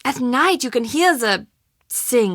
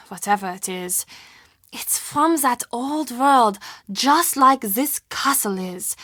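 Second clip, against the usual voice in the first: ordinary delivery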